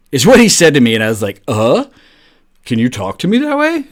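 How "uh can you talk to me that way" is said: high, stunned squeak of disbelief; a retold jaw-dropping shock, much higher. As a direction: blurt it out, caught off guard and shocked. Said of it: "like, shocked".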